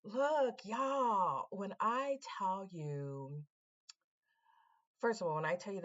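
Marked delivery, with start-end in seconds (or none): none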